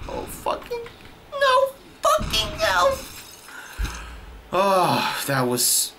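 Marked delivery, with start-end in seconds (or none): 0.1-3.0 breaking into a very high squeal; panicked squeal. Real, not acted. Player about to die in the game, panicked squeal. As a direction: start voiceless, then shriek in disbelief. Panicked scream during fight.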